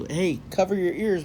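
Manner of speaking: usual speaking voice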